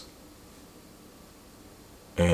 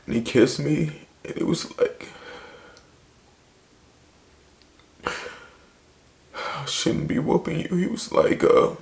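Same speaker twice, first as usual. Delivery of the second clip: real crying, higher and strained through the words; fighting back tears while telling it; tearful; 5 semitones higher than usual. Real, not acted. Voice strained after breaking down.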